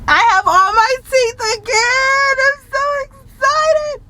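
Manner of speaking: high, near-crying squeal of joy; almost in tears with happiness; tearful excitement. Real, not acted. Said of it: "I'm so excited. I could freaking cry".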